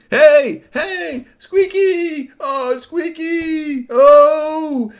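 high, loud worried calls; calling out. Acted. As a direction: cry his name, stretching it slowly. Kids calling to Squeaky.

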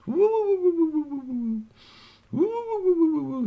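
very high wavering "woo-woo" hoot; strange alien snore. Acted. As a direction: snore in high, fluting trills like a space creature. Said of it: "heard this strange, strange sound".

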